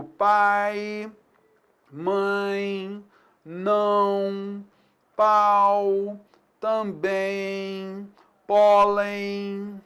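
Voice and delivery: each word held long and level; drawn-out demo words. Shown as a demonstration. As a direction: hold each word to show the glide. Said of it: "O som vai caindo".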